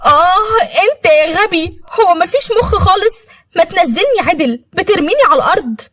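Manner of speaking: put-on a furious seventeen-year-old scolding him; angry teenage girl. A narrator's reading. Girl voiced in the story. (بنت مراهقة غاضبة بصوت رفيع)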